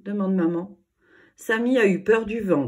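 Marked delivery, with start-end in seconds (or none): 1.4-2.6 teasing, louder little jab; a bit mocking. A narrator's reading. Julie telling on her brother at breakfast, mild teasing. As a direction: tease your brother in front of parents. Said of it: "déclare Julie un peu moqueuse".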